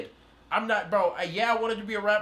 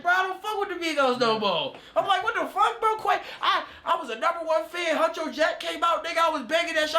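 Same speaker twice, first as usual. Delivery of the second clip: much higher, laughing shouts; hurt outburst; 9 semitones higher than usual. Retold or imitated. Complaining to a friend after Quay snubbed him, hurt laughing outburst. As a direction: rant like a betrayed fan. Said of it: "I was like".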